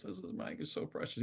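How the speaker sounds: high, soft imitation of cooing; cooing admirer. Imitating someone next to her in the circle, cooing. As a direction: coo it lightly, mimicking an admirer. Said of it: "someone next to me goes".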